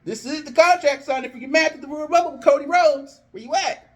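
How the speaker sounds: puzzled official calling backstage; calling out as Adam. Acted. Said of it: "Adam's like".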